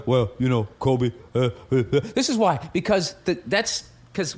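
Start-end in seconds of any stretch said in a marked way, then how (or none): 0.1-1.8 deep mock Shaq stalling an interview. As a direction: sink low and fumble through the uh's. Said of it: "when he do the".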